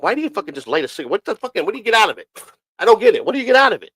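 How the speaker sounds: exasperated questioning, pitch climbing; baffled questioning. Retold or imitated. Retold baffled questioning of smokers.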